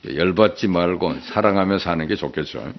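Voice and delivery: ordinary talk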